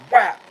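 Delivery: loud, high mock slap noise; imitated slap sound effect. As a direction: spit out a sharp whap. Imitated slap during story.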